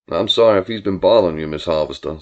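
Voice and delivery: low-pitched, folksy country man; easygoing drawling Billy. Acted. Billy greeting Julia about his cousin, folksy low voice. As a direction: lay on the low, courteous charm. Billy's dialogue, story character.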